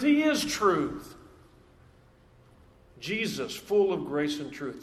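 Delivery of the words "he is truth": he said slowly, leaning on he; stress on he. Shown as a demonstration. Preacher contrasting speaking truth with being it, weight on he. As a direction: hit he, then slow down on is truth. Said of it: "He doesn't just speak the truth".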